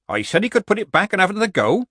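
high, quick, meek excuse. A narrator's reading. Old witch excusing herself over the crying boy, meek. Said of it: "said Granny meekly".

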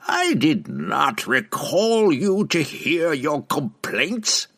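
put-on slow, high, cold old witch; haughty witch. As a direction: dismiss him with icy scorn. Benray's voice.

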